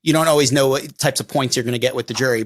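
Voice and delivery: ordinary delivery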